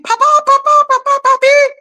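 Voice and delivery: high, loud imitated child's scream. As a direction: squeal it like a panicked little kid.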